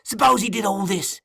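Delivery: high, forceful challenge. A narrator's reading. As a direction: press the question in an angry high voice. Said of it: "his eyes flashing with rage".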